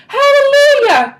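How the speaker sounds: very high, loud, slow cry; yelled. A narrator's reading. Grandpa yells in joy, very high and loud. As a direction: yell it out to the heavens. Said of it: "yelled Grandpa Joe".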